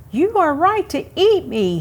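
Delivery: high, eager squirrel voice; pleading squirrel. Acted. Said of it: "he said".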